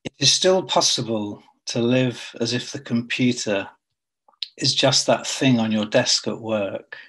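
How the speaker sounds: measured poem recital with long pauses between lines; poetry reading. Acted. Poet reading to an online audience, measured poetry reading. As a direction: read it line by line, letting each phrase hang.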